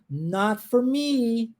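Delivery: "not for me" with "me" held long; intonation demo. Shown as a demonstration. Teacher saying look how I do it, lingering melody on "not for me". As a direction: draw out "not for me", sustaining the last word. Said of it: "Olha como é que eu tenho".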